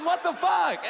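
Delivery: high-pitched imitation of a stunned cop; mock outrage. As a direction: exclaim it as the startled officer. Officer's retold reaction to U-turn.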